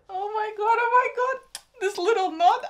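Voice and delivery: very high, loud fangirl squeal; excited squeal. Real, not acted. Fan watching the couple reunite, squealing with excitement. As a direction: squeal it, overwhelmed with delight.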